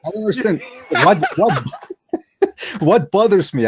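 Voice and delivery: laughing disbelief. Real, not acted.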